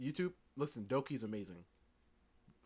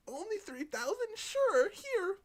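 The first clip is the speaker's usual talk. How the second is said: high-pitched, getting louder as it agrees; mock eager agreement; 7 dB louder, slower than usual. Artist paying Cat three thousand, high mock-eager voice. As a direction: jump at the offer in a raised voice.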